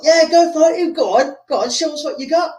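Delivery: high-pitched mock crowd goading; jeering spectators. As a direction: egg him on like a heckler in the stands. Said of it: "people that don't like him".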